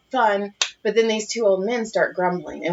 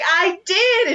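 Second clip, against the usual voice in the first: high chirpy admission; 11 semitones higher than usual. Retold or imitated. Retold admission.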